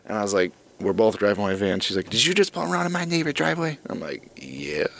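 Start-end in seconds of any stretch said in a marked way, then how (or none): 2.1-3.8 high-pitched nosy accusation; lightly voiced crabby woman, pitched up. Crabby homeowner questioning the driver, crabby. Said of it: "old crabby ass woman comes out". 4.4-4.9 low, soft, guarded reply; mock-hesitant, dropped in pitch and volume; wary. Driver answering the accusing homeowner, wary.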